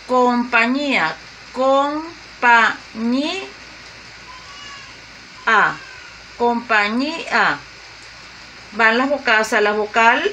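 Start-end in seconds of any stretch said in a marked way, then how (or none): 0.0-7.6 compañía drawn out slowly, each syllable apart; slow syllable split. Shown as a demonstration. Teacher sounding out compañía slowly for students. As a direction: spell out com-pa-ñí-a slowly for the class. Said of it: "tiene tres sílabas".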